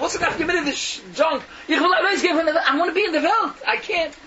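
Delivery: high, loud, aggrieved protest; indignant lament. Acted. Caged parakeet answers the envious free birds, indignant lament. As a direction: pour out the misery, pitched well up.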